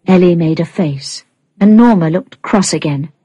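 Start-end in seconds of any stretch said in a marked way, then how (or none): none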